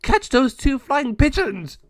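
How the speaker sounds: shouted order. Acted. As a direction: bellow orders at the flock. El Jago orders gargoyles.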